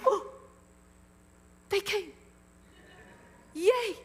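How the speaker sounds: high, bright squeal of joy; light up as they walk in; preacher retelling his glee at guests; delighted surprise. Retold or imitated. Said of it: "and I'm like".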